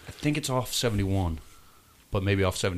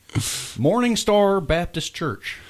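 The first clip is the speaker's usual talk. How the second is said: grand announcer voice; 10 semitones higher, 6 dB louder, much slower than usual. Acted. Announcing a church name.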